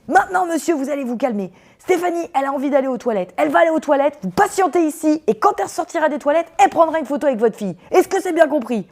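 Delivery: high, loud commanding ex-gendarme laying down the law; stern gendarme. Retold or imitated. Ex-gendarme friend ordering the angry father to wait. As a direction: order him to calm down, no arguing.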